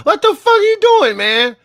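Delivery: high, loud laughing disbelief; shrieking disbelief. Real, not acted. Cohost reacting to the growling impression, high shocked laughing. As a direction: squeal it in shocked amusement.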